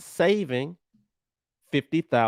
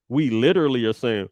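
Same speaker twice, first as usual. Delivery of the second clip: ordinary delivery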